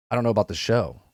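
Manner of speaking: dropped-down, quiet version of the doubt; different way. Retold or imitated. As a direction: say it low and hushed, reconsidering. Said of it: "in a different, complete different way now".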